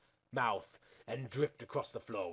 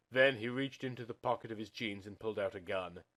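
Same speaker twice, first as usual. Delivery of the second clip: ordinary delivery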